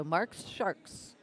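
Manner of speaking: careful, drawn-out team name; slow right down for the tongue twister. Real, not acted. Recapping the tongue-twister team name, slow and deliberate. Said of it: "have to say that slow every time".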